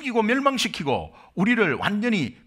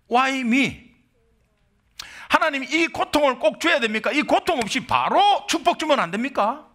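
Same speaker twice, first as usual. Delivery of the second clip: higher, pleading cry of why me to God; complaining prayer; 7 semitones higher than usual. Retold or imitated. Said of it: "간혹 이렇게 말할 수 있습니다". (하나님께 억울하게 따지는 기도)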